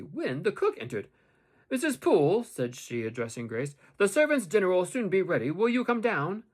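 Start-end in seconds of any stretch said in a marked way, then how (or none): 1.7-2.4 loud, high-pitched hail; brisk summons, pitched up; calling out. A narrator's reading. Cook calls to Grace on entering. 4.0-6.4 loud, high-pitched servant voice; busy cook calling out; cook's summons. A narrator's reading. Cook summons Grace to dinner.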